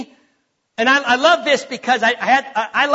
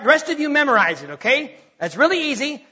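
ordinary talk

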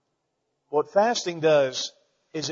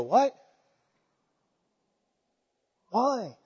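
bewildered; 7 semitones higher than usual. Retold or imitated. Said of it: "make you go".

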